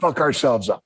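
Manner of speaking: ordinary delivery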